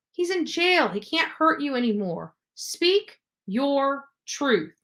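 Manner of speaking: ordinary talk, nothing marked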